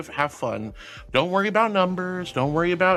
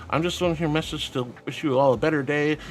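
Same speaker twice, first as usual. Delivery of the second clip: normal talking voice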